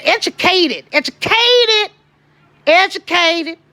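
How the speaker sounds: each educated pushed up high and drawn out; emphatic repetition. Real, not acted. Proud mother stressing educated, high and long. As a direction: lean hard on every educated. Proudly repeats son-in-law's credential.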